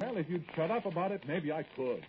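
high-pitched, exasperated retort; heated domestic argument; angry retort. Acted. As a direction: snap back at your wife, voice rising high. Heated quarrel between husband and wife.

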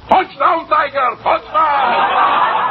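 high-pitched shouted cheer with laughter; mock football cheer. Cashier gleefully mocking the Princeton man's defense, shouted cheer. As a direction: cheer like a crowing Princeton fan.

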